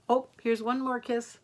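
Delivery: plain reading voice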